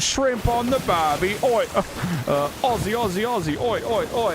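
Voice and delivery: hammed-up high Aussie accent with oi oi; mock Australian. Mocking an Outback Steakhouse ad with a jokey Aussie accent. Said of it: "I don't think Australians act like that".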